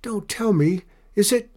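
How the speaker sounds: high, rising alarmed question; choked off in dismay. A narrator's reading. Alfie guesses who is calling, alarmed, cutting off. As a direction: break off as dread takes hold. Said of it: "Alfie's voice choked off".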